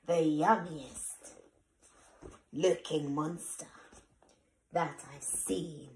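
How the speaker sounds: ordinary delivery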